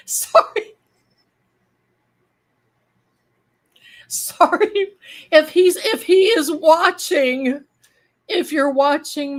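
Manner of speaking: high, loud voice after a laughing fit; laughing apology. Real, not acted. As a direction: squeak out an apology, still giggly. Apologises while recovering from laughing fit.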